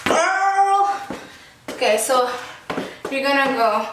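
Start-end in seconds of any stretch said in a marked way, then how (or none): 0.0-1.0 put-on high, sassy "Girl". Shown as a demonstration. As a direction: say it with attitude. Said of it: "with attitude, like".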